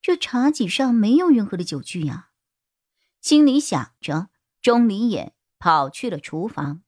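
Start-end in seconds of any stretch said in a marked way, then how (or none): none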